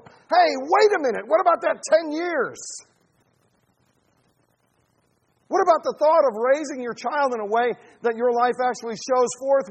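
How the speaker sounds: loud, high, driving sermon challenge; full-force preaching. Real, not acted. Preacher challenging parents in the church, loud and urgent. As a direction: press the congregation hard.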